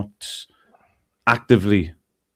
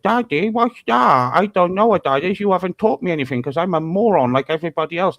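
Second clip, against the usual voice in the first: mock dim child; 6 semitones higher than usual. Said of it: "my son was like".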